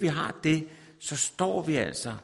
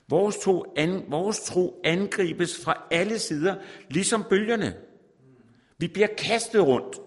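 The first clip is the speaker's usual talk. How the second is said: higher, louder fiery sermon; impassioned preaching; 5 semitones higher, 4 dB louder than usual. Acted. Preacher warning his congregation, in a higher, louder voice. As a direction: preach it with rising urgency.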